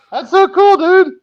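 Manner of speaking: very high, loud fangirl voice; gushing teenage girl. Pretend. Mod praising co-host's view count, gushing teenage-girl voice. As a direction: gush in a high girly squeal. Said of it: "you sound like a teenage girl".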